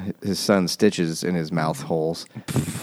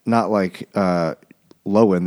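normal talking voice

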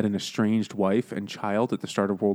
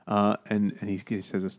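usual speaking voice

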